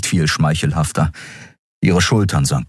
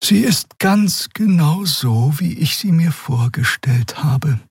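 a higher, gentle female character voice; Xea's voice; 10 semitones higher, slower than usual. A narrator's reading. Xea urging Keru to join, lifted voice. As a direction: coax him kindly in a lifted voice. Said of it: "sagte Xea".

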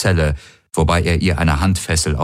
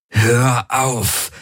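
snapped, raised pitch; harsh command; 6 semitones higher than usual. A narrator's reading. Man in agony commanding the Helix, harsh. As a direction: order the voice in your head to stop. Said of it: "herrschte er die Helix an".

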